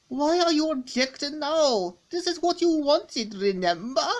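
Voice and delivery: high, put-on comic villain voice, pitched well up; character voice. Comic character dialogue read aloud.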